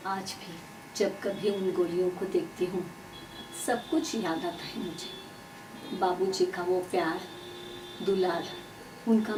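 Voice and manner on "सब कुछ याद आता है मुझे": plain reading voice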